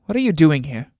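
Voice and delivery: ordinary talk, nothing marked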